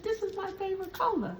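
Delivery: high, quick, thrilled lady's voice; delighted lady. Retold or imitated. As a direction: gush like she just got a gift. Lady voiced delighted over bracelet.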